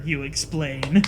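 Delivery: normal talking voice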